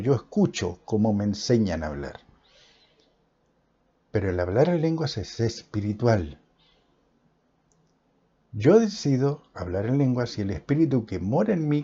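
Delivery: normal talking voice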